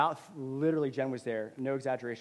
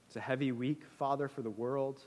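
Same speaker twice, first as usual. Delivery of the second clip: prayer. Real, not acted. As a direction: pray gently for the hurting world. Leads a prayer.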